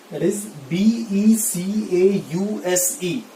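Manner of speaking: बी ई सी ए यू एस ई spelled letter by letter, slowly; slow spelling. Shown as a demonstration. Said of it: "क्या है?". (धीमे-धीमे spelling)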